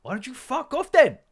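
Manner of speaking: high, loud yelled retort; hammed-up high shouting in a squabble; mock shrill shout. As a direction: screech it back like a kid in a row. Acted quarrel, high shouted retort.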